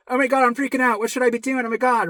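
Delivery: put-on anxious fretting; mock freak-out. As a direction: imitate someone flustered and panicking. Acting a panicking sufferer's reaction.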